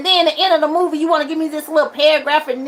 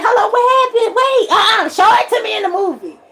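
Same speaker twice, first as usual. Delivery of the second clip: mock outcry; 8 semitones higher, 3 dB louder than usual. Mock reaction to movie ending.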